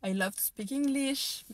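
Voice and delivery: a slow, bright "I love to speak English"; lifted, slow English phrase. Shown as a demonstration. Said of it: "parce que juste j'adore".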